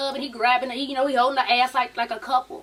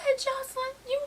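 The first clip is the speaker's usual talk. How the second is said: high sweet mock calm; 12 semitones higher than usual. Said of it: "she was real calm".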